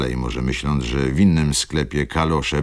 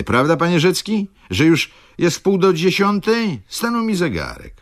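high, feigned innocence; innocent young clerk; 13 semitones higher, 3 dB louder than usual. Acted. Clerk asks Rzecki the time, high and innocent. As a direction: play dumb about the hour. Mraczewski fishing for early leave.

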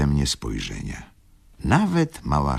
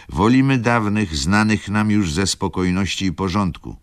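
higher, louder firm reply; landlord's reply; 7 semitones higher, 5 dB louder than usual. Acted. Landlord answering the lady, higher and louder. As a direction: state it firmly and proudly. Narrator quotes his own reply.

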